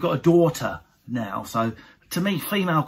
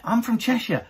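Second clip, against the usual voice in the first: higher, prim insistence on Cheshire; mock posh wife; 7 semitones higher than usual. Husband mocking wife who claims Cheshire, prim high voice. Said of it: "her and her mum say they're from Cheshire".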